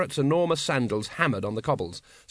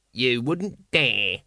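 sneering guard; much slower than usual. Acted. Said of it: "said one of the men".

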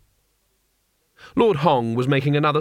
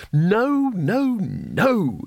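pitched-up, drawn-out exasperation; exasperated teacher; 7 semitones higher, much slower than usual. A narrator's reading. Mister Saveloy refusing a plan to kill everyone, exasperated. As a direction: plead like a teacher losing control of a class. Teacher stops dangerous old pupils.

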